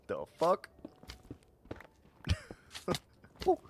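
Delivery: ordinary delivery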